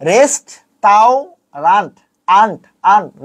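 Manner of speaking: "রেস্ট তাও রান্ট" slowly, one syllable at a time; "রেস্ট তাও রান্ট" sounded out with an open "ant" ending; spelling pronunciation. Shown as a demonstration. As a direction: pause between "রেস্ট", "তাও" and "রান্ট", then repeat "আন্ট". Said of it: "ভাই, এটা না রে ভাই".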